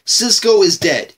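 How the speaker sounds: normal talking voice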